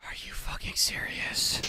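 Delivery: breathy whisper of real disbelief. Real, not acted. Gamer stunned at his death, whispering.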